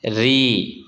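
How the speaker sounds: री with a light r and long ee; thin out the r of री, hold the ee. Shown as a demonstration. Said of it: "रा को बारीक पढ़ना है".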